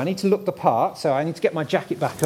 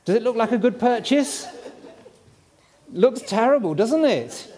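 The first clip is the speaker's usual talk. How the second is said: gushing real estate agent; 6 semitones higher than usual. Pretend. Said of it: "do my best estate agent impression".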